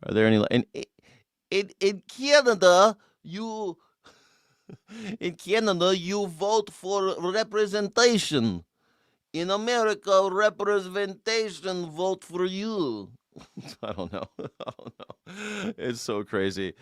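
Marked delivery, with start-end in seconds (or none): 1.5-13.1 put-on high, slow Russian comic accent; singsong Russian-accented joke delivery; Yakov Smirnoff. Streamer improvising a joke about Canada, high Russian accent. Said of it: "a Yakov Smirnoff joke".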